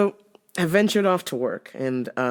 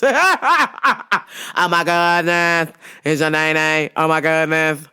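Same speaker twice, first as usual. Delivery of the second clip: slow, drawn-out sitcom gush; Shanae impression; 3 dB louder, much slower than usual. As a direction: stretch it like the sitcom character. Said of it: "Shanae from Martin".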